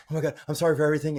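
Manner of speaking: high, rushed pleading, imitating a frightened passenger; mock panic. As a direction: blurt a hammed-up last-minute repentance. Mimicking panicked passengers suddenly repenting.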